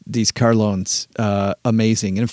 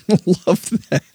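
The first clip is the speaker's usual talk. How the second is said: amused, higher voice breaking into laughter; laughing; 5 semitones higher than usual. Real, not acted. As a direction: laugh through the praise. Cracks up at listener's joke.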